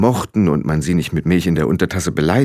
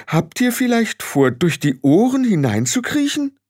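high, incredulous question; appalled; 13 semitones higher than usual. A narrator's reading. Frau Grad asks if they'd crawl through ears, appalled. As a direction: ask in horrified disbelief, pitched up. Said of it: "sagte Frau Grad entsetzt".

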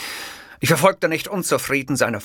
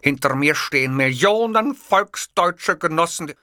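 pompous declaration; 7 semitones higher, 4 dB louder than usual. Acted. Narrator's Hitler persona declaring.

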